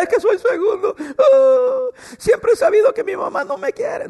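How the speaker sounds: high, mock-weepy self-pity; whiny crying complainer. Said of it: "No se ponga a llorar".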